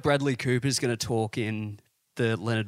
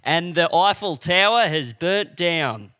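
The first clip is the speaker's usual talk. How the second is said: slow, louder mock newsreader; raised, slowed-down bulletin voice; Karl Stefanovic impression; 4 semitones higher, 5 dB louder, slower than usual. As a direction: deliver dire news like a TV reporter, drawn out. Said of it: "cuts to Karl Stefanovic".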